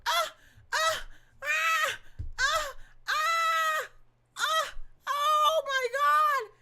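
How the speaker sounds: high, loud mock cries of the judge; hamming up her screams; imitated screaming moans. Mocking the judge's screams to the viewers, high and loud. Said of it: "and she was".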